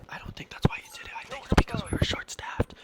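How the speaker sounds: quick, real whisper with no voice; hushed side comment to the viewer; whispered aside. Real, not acted. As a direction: confide your doubt under your breath.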